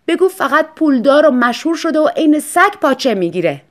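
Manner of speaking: high, loud, bitter jab; bitter retort. Acted. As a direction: lash out at herself. Wife mocks herself bitterly. (طعنهٔ تلخ و بلند)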